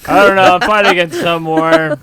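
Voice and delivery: confused soldier. Retold or imitated. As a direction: play a clueless soldier shouting he doesn't know.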